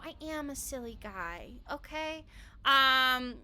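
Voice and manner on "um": ordinary talk, nothing marked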